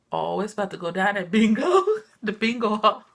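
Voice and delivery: loud, laughing hype; cracking up at the bingo news; laughing excitement. Retold or imitated. Laughing to the viewers about bingo. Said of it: "And I'm like".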